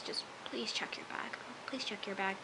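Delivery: hushed, urgent begging aside; whispered plea. Retold or imitated. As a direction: whisper it as if willing her to listen. Silently pleading with a character.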